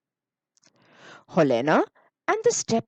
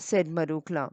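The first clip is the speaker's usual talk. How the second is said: usual speaking voice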